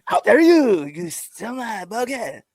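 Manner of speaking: high, slow hammed-up French accent; mock French accent. Host jokes about a stolen baguette, in a high mock French accent. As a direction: play an outraged Frenchman, pitched high. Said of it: "You sound French as fuck".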